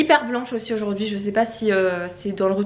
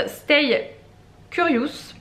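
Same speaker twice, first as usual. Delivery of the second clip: slow, careful English in a French accent; 4 semitones higher, much slower than usual. Real, not acted. Vlogger reading out a lipstick shade, self-conscious English accent. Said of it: "avec mon accent anglais".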